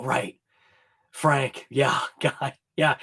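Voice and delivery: ordinary talk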